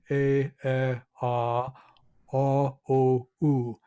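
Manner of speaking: "A, E, Ah, Ah, O, U" each vowel sung out separately and held; vowel series. Shown as a demonstration. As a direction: sound "A, E, Ah, Ah, O, U" one by one as pure sustained vowels. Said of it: "I'm gonna pronounce E".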